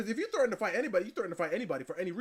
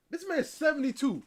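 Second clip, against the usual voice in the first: shocked; 8 semitones higher, 6 dB louder than usual. Real, not acted.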